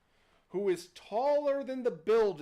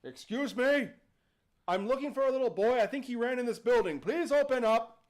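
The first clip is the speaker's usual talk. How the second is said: raised, quick, courteous call; polite genie; 4 semitones higher, 5 dB louder than usual. Acted. Genie knocks looking for a little boy, polite loud call. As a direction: call politely through a closed door. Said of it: "The most polite mass murderer".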